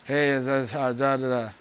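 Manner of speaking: low monotone drone; low energy. Retold or imitated. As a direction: mimic a dull, inexpressive talker. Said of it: "Low energy".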